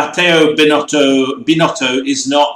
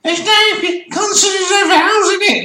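mocking. Mocking people who claim Welsh has no vowels. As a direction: sneer at the lazy complainers. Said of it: "mocking people who can't read Welsh".

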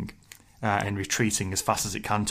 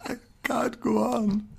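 high, slow, terrified voice; terrified coward; 13 semitones higher, slower than usual. Acted. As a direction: play a terrified coward lost in snow. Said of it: "superb impression of a terrified coward wandering through endless snow".

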